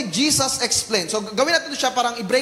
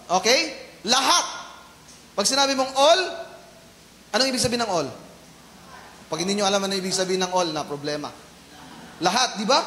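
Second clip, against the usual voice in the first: ordinary talk, nothing marked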